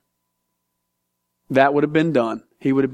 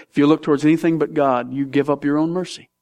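normal talking voice

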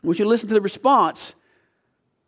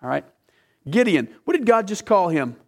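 ordinary delivery

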